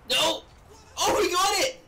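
high, loud burst of relief and joy; excited yell. Real, not acted. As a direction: shout with glee as the save lands. Saves a goal, celebrates.

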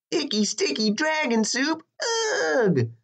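high, squealed disgust; disgusted princess cry. A narrator's reading. Princess rejecting the dragon's soup, high disgusted cry. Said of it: "cried the princess".

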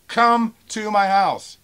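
very slow, high, loud challenge; real pissed-off shout. Real, not acted. Seller daring a threatening buyer, slow angry shout. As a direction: stretch out each word as you shout. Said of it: "Now I'm pissed off reading this".

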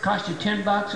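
ordinary talk, nothing marked

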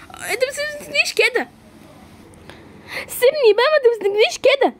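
high, frantic protest; panicked struggle. Acted. Girl held by thugs, high panicked protest. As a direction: fight them off in panic.

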